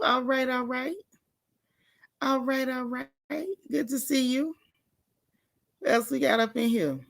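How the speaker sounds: normal talking voice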